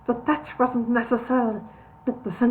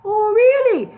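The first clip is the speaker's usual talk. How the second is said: mock surprise; 11 semitones higher, 7 dB louder, slower than usual. A narrator's reading.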